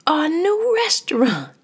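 excited high shout. A narrator's reading. Pierre and Marie bursting in, shouting. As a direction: shout it with glee. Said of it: "they shouted".

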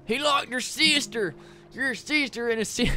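put-on high chirpy southern accent; southern bird. Caged bird tells Pete about his sister, high southern accent. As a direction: gossip like a worried little southern bird. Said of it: "the bird has a southern accent".

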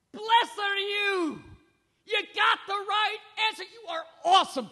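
loud encouragement. Acted. Jesus praising Peter, shouted joyful encouragement. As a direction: cheer him on with everything. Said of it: "Jesus encourages him".

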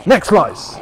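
loud, high move announcement; shouted attack call. Pretend. Player announcing his slicing move, loud shout. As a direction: belt it out like a special move.

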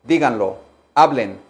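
usual speaking voice

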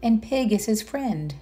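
ordinary delivery, nothing marked

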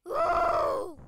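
loud, very high whoop; excited shriek. Real, not acted. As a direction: let out a high "ooh" at a close call. Cries out at intense game moment.

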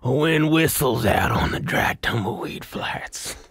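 put-on slow, rough old cowboy drawl; gravelly cowboy voice. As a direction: narrate it like a weathered old cowboy. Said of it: "do this part in a cool, old, like, gravelly cowboy voice".

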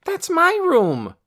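high, loud, slow outrage; protesting cry. A narrator's reading. Boy defending his room from guests, loud. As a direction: plant your feet and protest. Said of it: "cried Torin".

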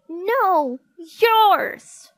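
very high, loud shout back; Margaret's retort shout. A narrator's reading. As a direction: yell it back in a childish squabble. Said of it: "shouted Margaret".

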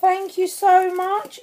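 high, slow, gushing thanks; heartfelt gratitude. Real, not acted. Vlogger telling her friend how kind she is, deeply grateful. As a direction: draw out the thanks, lifted and sincere. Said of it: "Words just don't describe".

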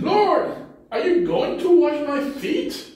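high, astonished question; surprised Peter. Acted. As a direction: voice Peter's disbelief. He's very surprised.

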